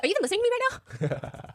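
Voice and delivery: high-pitched, exasperated wife imitation; indignant mock demand. Wife's demand in retold quarrel.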